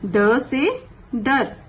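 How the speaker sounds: ड से डर drawn out very slowly; slow clear pronunciation. Shown as a demonstration. Teacher sounding out ड से डर slowly for pupils. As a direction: sound out ड से डर carefully. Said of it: "देखिए".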